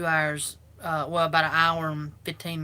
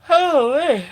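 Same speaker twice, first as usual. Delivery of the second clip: words stretched through a yawn; 10 semitones higher, 14 dB louder than usual. Real, not acted. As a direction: say it mid-yawn. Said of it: "I'm sorry about the yawning".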